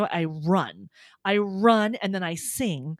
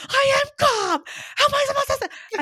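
very high, loud shriek, anything but calm; hammed-up frantic yell, pitched way up; retold hysterical protest; 7 dB louder than usual. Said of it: "you have to calm down".

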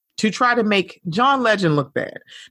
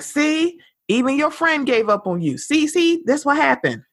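mocking online crowd; 7 semitones higher than usual.

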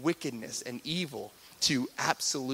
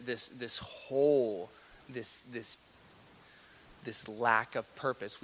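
ordinary talk, nothing marked

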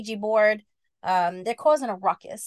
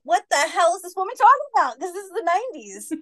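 higher, louder bafflement; incredulous kids; baffled boys; 8 semitones higher, 5 dB louder than usual. Acted. The boys react to the zombie, incredulous.

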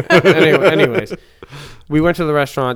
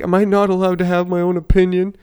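higher mock whine; whiny kid; 8 semitones higher, 5 dB louder than usual. Kid protesting at the diner, mock whine. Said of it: "he was saying, like".

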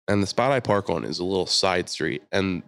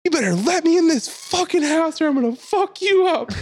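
very high, loud threat; imitated furious scream; 5 dB louder than usual. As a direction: scream a demand to be let in, as the woman. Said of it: "She's like".

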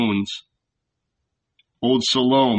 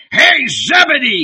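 much higher, loud bellow; bellowing shout; 12 semitones higher, 7 dB louder than usual. A narrator's reading. Barabbas bellows at Zebedee from the threshold, shouting. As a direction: bellow the name across the yard. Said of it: "bellowing ... he shouted".

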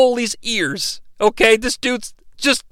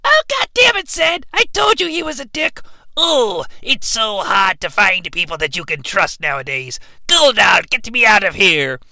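chipmunk voice. Acted. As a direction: squeak it like a shrunken chipmunk. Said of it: "sort of chipmunk voice 'cause they're tiny".

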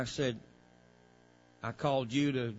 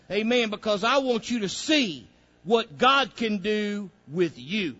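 loud, higher, driving preacher's call; full-force preaching; 7 semitones higher, 8 dB louder than usual. Acted. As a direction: preach it hard to the congregation.

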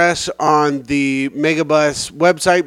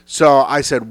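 normal talking voice

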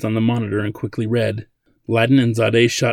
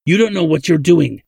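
raised, insistent scolding; angry; 5 semitones higher, 5 dB louder than usual. A narrator's reading. Old man doubts Ladin's ability, angry. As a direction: scold him with rising irritation. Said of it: "Simic replied angrily".

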